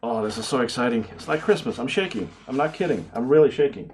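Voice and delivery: ordinary talk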